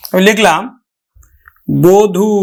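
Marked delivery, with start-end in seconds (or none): 1.7-2.3 বধূ drawn out as "bo-dhu"; closed first vowel. Shown as a demonstration. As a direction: round the vowel after ব in বধূ to o. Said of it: "আদ্য অটা ও এর মতো". (বধূ-কে "বোধু" উচ্চারণ)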